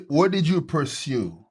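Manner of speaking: ordinary talk, nothing marked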